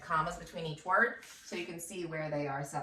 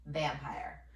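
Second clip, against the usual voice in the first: normal talking voice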